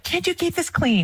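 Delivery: high-pitched exasperated complaint; annoyed. Retold or imitated. Mom at kids over the messy toy room, mock annoyed. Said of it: "I'm really annoyed and I'm like".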